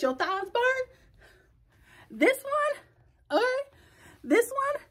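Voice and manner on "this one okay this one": high sing-song. Real, not acted.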